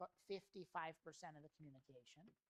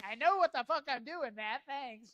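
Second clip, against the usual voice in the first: loud, high annoyed shout; acted furious outburst, sarcastic thanks; angry yelling; 8 semitones higher, 16 dB louder than usual. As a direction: yell it like an irritated brother firing back. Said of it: "annoyance and anger and, and yelling almost".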